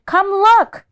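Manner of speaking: put-on high, loud children's call; excited shout. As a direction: shout for everyone to come. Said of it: "cried and said".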